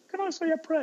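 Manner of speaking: high, imitated kid's voice; small child. One kid asking teacher after the bad news, small child voice. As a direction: put on a pupil's high little plea. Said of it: "one kid said".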